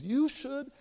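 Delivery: high, suspenseful proud fragment; mock proud. Elder woman stretching out her advice, proudly teasing. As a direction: dangle the advice and stop short. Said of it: "she looks at me proudly".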